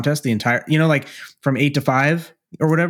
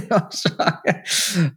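laughing apology; 5 semitones higher, much slower than usual. Real, not acted. Giggling apology to bumped guests.

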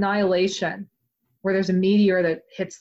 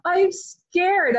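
much higher, whiny admission of fear; pitched-up playful fear; high mock fright; 9 semitones higher, much slower than usual. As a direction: squeak it out like a scaredy-cat. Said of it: "And I'm like".

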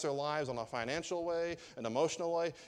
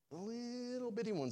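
LITTLE BITTY pitched way up, softly; squeaked-up, softer "little bitty"; teeny-tiny emphasis; 9 semitones higher, 5 dB quieter than usual. Shown as a demonstration. Preacher repeats the phrase for effect.

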